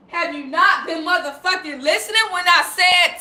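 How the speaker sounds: usual speaking voice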